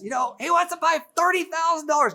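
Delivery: the wife reporting the offer to her husband; Lisa voiced. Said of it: "And Lisa says".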